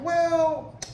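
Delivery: high, drawn-out hedging "well"; hesitant imitation. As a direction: stall on a high-pitched word. Voices his hesitant reply.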